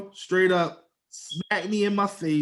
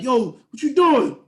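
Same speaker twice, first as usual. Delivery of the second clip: raised, high-pitched mock challenge; retold confrontation; 6 semitones higher, 6 dB louder than usual. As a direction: call her out loudly, acting it out. Retells confronting the fighting woman.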